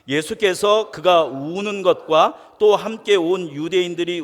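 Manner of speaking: slow, solemn Bible reading; scripture reading. Acted. Pastor reads John to the church, slow and solemn. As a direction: read the verses slowly and weightily.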